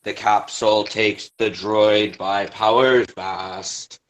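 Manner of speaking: even, formal chorus narration; verse narration. A narrator's reading.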